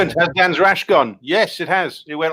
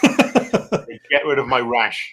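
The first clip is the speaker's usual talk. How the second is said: slow mock ad voice; 4 semitones lower, much slower than usual. Mock advert line.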